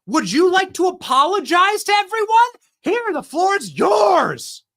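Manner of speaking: hammed-up gotcha at the moderator; mock confrontation. Shown as a demonstration. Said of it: "Go to Kristen Welker and say".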